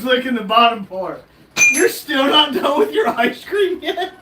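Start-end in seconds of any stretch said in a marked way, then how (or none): 1.7-4.1 laughing tease. Real, not acted. Teasing him about the ice cream.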